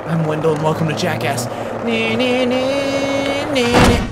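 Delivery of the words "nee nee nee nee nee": high siren noise; nee-nee like a siren; playful high siren sound; mock siren. Siren noise while skating.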